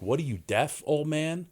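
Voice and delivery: mocking rude customer. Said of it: "the guy's like".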